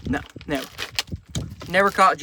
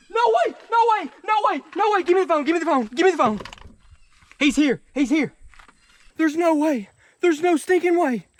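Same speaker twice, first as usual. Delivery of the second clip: high, loud, breathless no-way shouting; can't believe his eyes, yelling high and loud; thrilled disbelief; 8 semitones higher, 5 dB louder than usual. Real, not acted. Spots rare albino catfish.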